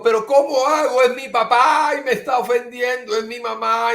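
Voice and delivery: high pleading voice of a hurt person; anguished complaint. Retold or imitated. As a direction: plead as if the father hurts you. Quoting a distressed listener. (queja angustiada, aguda y suplicante)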